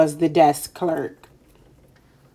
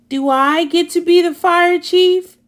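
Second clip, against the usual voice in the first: high, loud hopeful asking; eager plea; 9 semitones higher, 4 dB louder than usual. Acted. Wendell asking Sophie eagerly, high hopeful plea. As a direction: beg to be chief.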